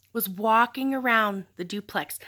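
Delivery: ordinary delivery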